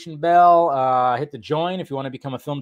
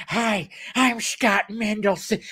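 slow, higher goofy guest voice; a light Little Nicky-ish impression of him; imitating the guest; 5 semitones higher, 5 dB quieter, slower than usual. Retelling the stream moment to viewers, mock goofy guest voice. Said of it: "did he sound a little like Little Nicky".

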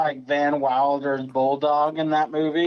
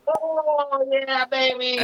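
high, drawn-out hammy moan; mock pleasure moan; 8 semitones higher, much slower than usual. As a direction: ham up the bliss of hot water. Sinking into the bath.

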